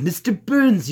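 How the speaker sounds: eccentric, joyless tycoon voice; Mister Burns. Shown as a demonstration. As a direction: swoop up on the name with little cheer. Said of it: "not so happy tone into it".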